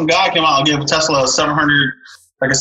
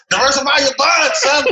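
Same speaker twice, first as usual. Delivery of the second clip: higher, hammed-up advice voice; imitating a finance guru; 11 semitones higher than usual. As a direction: play a smug money coach lecturing his son. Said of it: "What was, uh, Jason Rock?".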